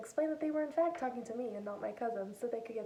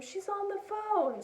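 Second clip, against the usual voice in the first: much higher, slow call across the room; 10 semitones higher, 4 dB louder, slower than usual. Retold or imitated. Grandpa calling to grandma about the call, much higher and slow. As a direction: announce it to the house.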